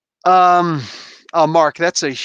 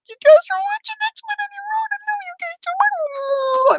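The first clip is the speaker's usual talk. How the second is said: very high squealing voice; shrill delighted falsetto; mock excited high voice; faster than usual. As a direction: squeal it way up high like a thrilled cartoon fan. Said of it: "I was like".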